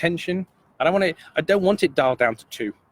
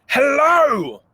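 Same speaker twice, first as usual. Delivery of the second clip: much higher and louder "hello", full of energy; energetic hello; 10 semitones higher, 5 dB louder than usual. Shown as a demonstration. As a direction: throw real energy into "hello". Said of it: "just so much energy behind it".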